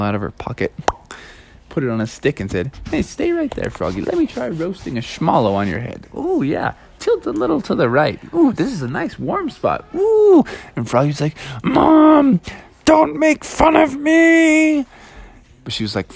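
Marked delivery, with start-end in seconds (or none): 2.9-10.5 high, playful cartoon mom; teasing mom. Mom roasts a marshmallow on Froggy's head, teasing. 11.6-14.9 high, drawn-out indignant wail; whiny angry protest. Acted. Froggy tells mom to stop teasing, indignant wail.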